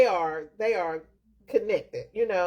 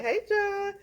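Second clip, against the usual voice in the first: mock greeting call; 12 semitones higher than usual. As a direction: pretend to call out to a friend, pitched up. Mimics greeting a name seen on screen.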